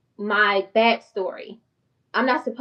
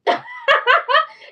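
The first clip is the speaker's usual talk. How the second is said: high burst of laughter; 6 dB louder than usual. Real, not acted. Laughs after boasting.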